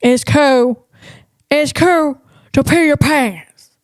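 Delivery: another stab at Sandler's dopey delivery; Adam Sandler impression. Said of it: "I'm not there yet".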